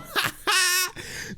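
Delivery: shrill burst of laughter; high laugh. Real, not acted. Streamer cracks up at debate.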